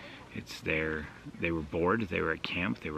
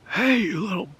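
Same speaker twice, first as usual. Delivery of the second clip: sudden high shout, much louder and higher; angry yell; 14 semitones higher, 8 dB louder than usual. Retold or imitated. Homeowner yelling at the kids vandalizing his yard, angry shout. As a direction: bark it out like a furious dad catching kids.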